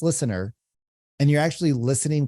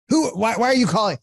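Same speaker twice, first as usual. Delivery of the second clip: higher, louder, fast why are you calling; upset homeowner; 9 semitones higher, 4 dB louder, faster than usual. Retold or imitated. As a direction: snap back irritated. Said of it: "She was upset".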